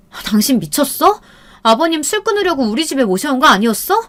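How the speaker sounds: exasperated scolding. Acted. Wife scolds drunk husband.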